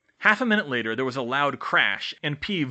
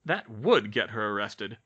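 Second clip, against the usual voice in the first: ordinary delivery, nothing marked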